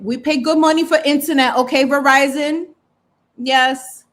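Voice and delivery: sassy scolding. Real, not acted. Scolding her internet provider.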